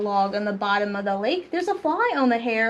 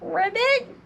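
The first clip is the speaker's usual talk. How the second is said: high, squeaky frog call; higher-pitched playful ribbit; little pig's ribbit; 9 semitones higher than usual. Acted. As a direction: chirp it cutely like a little pig playing frog. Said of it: "the little pig said".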